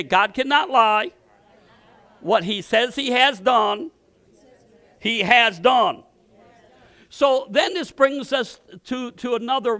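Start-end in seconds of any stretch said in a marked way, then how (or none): 0.0-6.0 slow, measured call for the church to repeat; slow declaration. Shown as a demonstration. Pastor leading the congregation in a slow confession. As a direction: lay each word down slowly for the room to echo.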